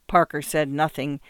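ordinary delivery

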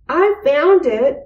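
high insistent "I found it"; arguing. A narrator's reading. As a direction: insist on it against a friend. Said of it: "argued Arthur".

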